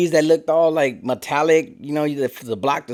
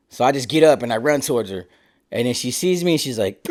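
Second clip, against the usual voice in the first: ordinary delivery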